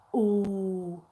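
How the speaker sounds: low, long held "Oh"; drawn-out low "Oh"; vowel demo. Shown as a demonstration. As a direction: hold a pure rounded "Oh", sliding down. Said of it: "Say, "Oh".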